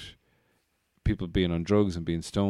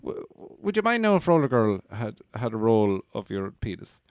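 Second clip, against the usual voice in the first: a much higher, over-the-top mock Irish voice; hammed-up Irish accent; 9 semitones higher than usual. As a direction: ham up a terrible Irish brogue, way up high. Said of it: "the worst Irish accent he's ever heard".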